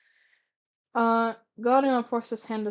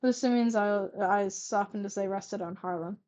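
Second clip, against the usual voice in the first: plain reading voice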